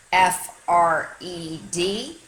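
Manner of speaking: slow letter-by-letter E M M A; spelling out letters. Shown as a demonstration. Dog spelling a new friend's name, slow letters. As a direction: space each letter out. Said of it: "spelled their name".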